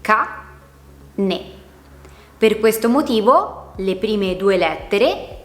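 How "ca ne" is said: CA, pause, NE; separate syllables. Shown as a demonstration. Teacher splitting cane into two voice emissions, syllable by syllable. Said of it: "pronunciata con due emissioni di voce separate".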